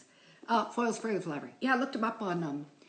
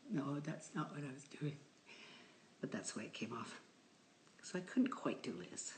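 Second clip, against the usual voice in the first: whisper; 3 semitones lower, 9 dB quieter than usual. Real, not acted. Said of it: "confessional aside".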